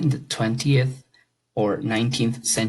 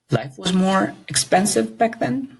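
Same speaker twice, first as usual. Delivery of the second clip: higher, rising quizzical tone on back then; doubtful question; 8 semitones higher than usual. Shown as a demonstration. Teacher checking a true-or-false sentence, quizzically rising. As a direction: question the claim as if you doubt it. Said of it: "So let's see".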